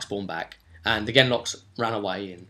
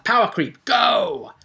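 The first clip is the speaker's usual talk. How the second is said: mock battle cry; 12 semitones higher, 5 dB louder, slower than usual. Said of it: "and it's like".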